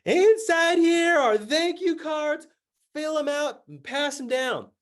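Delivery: high, loud, drawn-out yell; yelled instructions. Retold or imitated. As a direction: yell it slowly across to a crowd. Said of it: "yelling the instructions at them".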